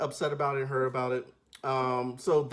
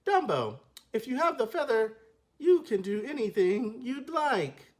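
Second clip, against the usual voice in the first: wooden delivery; 7 semitones higher than usual. Shown as a demonstration. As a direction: read it like cardboard. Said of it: "Here's how they delivered the line".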